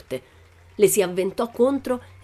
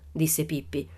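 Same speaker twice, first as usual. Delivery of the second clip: ordinary delivery, nothing marked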